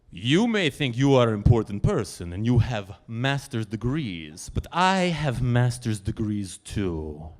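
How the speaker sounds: put-on low, slow Polish accent, proud; haughty Polish lady. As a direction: lecture him from above. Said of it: "Teju, you will make no comments".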